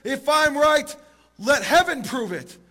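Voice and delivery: loud, high appeal, slowing at the end; desperate calling out. Retold or imitated. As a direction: cry out to heaven as a last resort. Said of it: "he calls out and he says".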